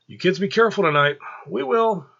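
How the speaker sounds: female voices. Acted. Angela warning the kids at the door, high and caring. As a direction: send the kids off with care.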